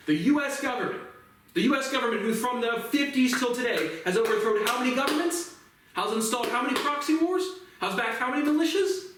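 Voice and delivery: high, rising rhetorical questions; real indignation at US hypocrisy; indignant. Real, not acted. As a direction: hammer each question pitched high. Indignant rant at government.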